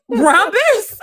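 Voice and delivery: high laughing question; laughing disbelief. Real, not acted. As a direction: laugh through the word.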